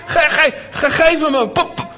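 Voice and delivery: put-on high, loud stammering little girl; angry stutter. Upset Lena demanding her doll back, high stuttering plea. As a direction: stutter it out in frustration. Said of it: "begon ze een beetje te stotteren".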